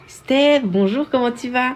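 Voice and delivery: high, loud call to someone upstairs; calling out to mum. Real, not acted. Streamer calls out hello to her mum upstairs, loud and high. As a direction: sing out a greeting across the house. Greets her mother from afar.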